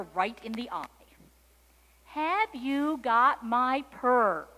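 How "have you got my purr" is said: loud, very slow kitten question. A narrator's reading. Kitten eye to eye with cow, asking loudly and slowly. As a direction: ask it slowly at raised volume.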